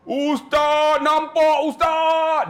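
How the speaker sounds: high, very drawn-out mock cry of amazement; imitated wailing shout. Pilgrim to his ustaz on seeing the Kaabah, mock wailing. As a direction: wail it out loud, stretching each word.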